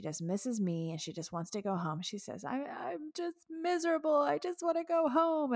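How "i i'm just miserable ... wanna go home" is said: put-on high, whiny teary little-girl voice, much higher; imitating daughter bawling. Daughter on FaceTime missing mom, mock bawling voice. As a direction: mimic a homesick child sobbing out her words. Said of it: "she's just bawling, crying".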